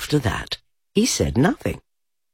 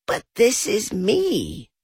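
surprised; 12 semitones higher, slower than usual. A narrator's reading. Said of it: "very much surprised".

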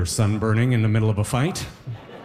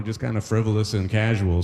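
ordinary delivery

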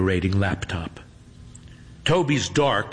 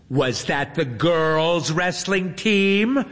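teasing; 7 semitones higher, slower than usual. A narrator's reading. Teasing chat message read.

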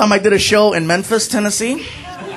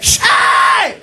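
put-on high-pitched drunk guy act; mirror guy; 8 semitones higher, slower than usual. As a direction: squeal it high like a silly drunk. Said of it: "or the mirror guy".

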